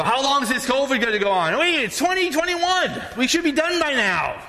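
high-pitched mock whine; impatient complaint. Complaining to God about covid, mock exasperation.

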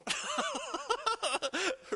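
a real high burst of laughter. Real, not acted. Pastor laughing at his singing story, high laugh.

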